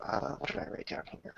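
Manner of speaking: whispering to himself, fast; a hurried private whisper over notes; whispered aside. Real, not acted. Game master checking his notes, fast whisper to himself.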